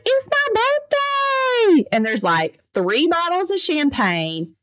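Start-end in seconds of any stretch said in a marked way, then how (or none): 0.0-1.9 drawn-out, sing-song, much higher tipsy husband; tipsy birthday boy. Retold or imitated. Husband on the porch announcing his birthday, tipsy sing-song. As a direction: stretch it out like a happy drunk celebrating.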